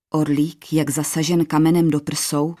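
ordinary delivery, nothing marked

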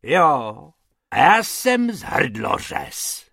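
gruff, slowed sneer; grumpy accordionist roaring at the rabbits; mock roar. A narrator's reading. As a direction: threaten them theatrically. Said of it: "zařval pan Hrubec".

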